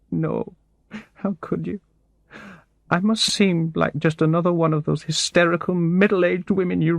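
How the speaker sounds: very high, desperate voice on the edge of sobbing; a woman whose world has fallen apart; breaking down. A narrator's reading. Barbara near collapse.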